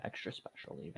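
whisper. Pretend. Joking about special candy bars.

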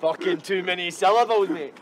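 mocking twang. Mocking his mate's talk of too many syllables, mimicked twang. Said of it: "Get the fuck that twang".

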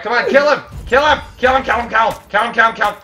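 high, loud urging chant; excited shouting. Real, not acted. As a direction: egg them on loudly. Urging teammate during takedown.

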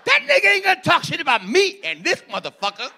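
high, loud angry street yell; mock mad yell. Mocking angry guys at a comedy show, loud mad yell. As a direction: blow up like you're mad. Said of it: "we say when we're mad".

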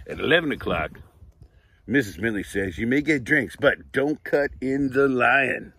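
lively, bright read-aloud storytelling; reading to children. Acted. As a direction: read it like story time for little kids. Reads picture book aloud.

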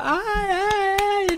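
high mock "ay, ay" of gossiping girls; mocking housemates. Streamer mocking the housemates' gossip, high teasing wail. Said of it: "recién estaban todos".

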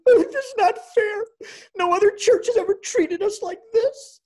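very high, loud tearful complaint; hammed-up crying protest, voice shot way up; mock wailing. Wife in the car after getting tests, mock tearful wail.

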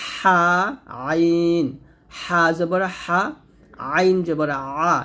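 হা and আইন squeezed from mid-throat; pressed throat letters. Shown as a demonstration. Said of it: "চাপ দিয়ে". (চাপ দিয়ে হা, আইন)

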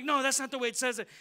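loud, fast, high scolding; angry correction. Retold or imitated. Preacher reenacting scolding his brother's reading, angry yell.

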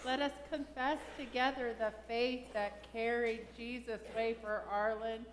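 ordinary talk

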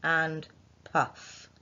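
"to huff and puff" very slow, word by word; slow dictation. Shown as a demonstration. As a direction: dictate each word slowly. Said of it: "Remember that sentence".

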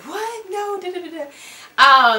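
imitating mom's stunned no; shocked reaction. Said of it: "they were like".

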